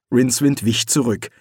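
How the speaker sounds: ordinary delivery, nothing marked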